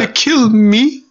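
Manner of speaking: high, slow, drawn-out question; mock gruff villain. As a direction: stretch the words in a put-on voice. Mocking the movie line.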